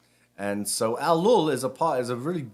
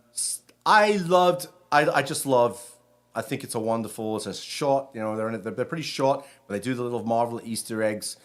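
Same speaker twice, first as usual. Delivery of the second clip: ordinary talk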